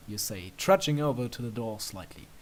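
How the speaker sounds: ordinary delivery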